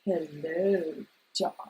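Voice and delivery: put-on breathy old Hollywood purr; breathy vintage purr. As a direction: purr it like a vintage film star. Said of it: "Which one of those voices".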